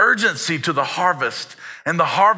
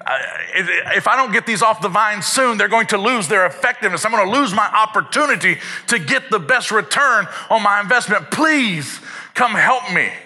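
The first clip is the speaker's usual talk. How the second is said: higher, louder anxious plea for harvest hands; pleading for help; 7 semitones higher, 5 dB louder than usual. Retold or imitated. Landowner pleading with townsfolk, higher and louder. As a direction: beg them, the grapes are spoiling. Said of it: "we'd go back and we'd say".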